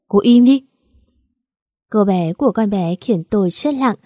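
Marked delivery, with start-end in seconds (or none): 0.1-0.6 louder, indignant outburst; raised-voice order to shut up; angry command. Acted. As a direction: cut her off forcefully. Heated quarrel, cutting rival off.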